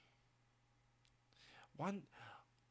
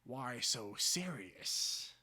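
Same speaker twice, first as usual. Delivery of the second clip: very slow drawn menace; slow menacing Joker line; Joker father; 3 semitones lower, much slower than usual. Acted. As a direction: stretch every word, threatening. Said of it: "My father sees me".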